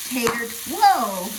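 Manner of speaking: startled whoa mid-sentence. Real, not acted. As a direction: jump in surprise. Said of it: "That scared me".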